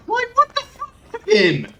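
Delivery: high squeal of laughing disbelief; disbelieving laugh. Real, not acted. Streamer laughing in disbelief at the game, laughing yell. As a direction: laugh it out helplessly.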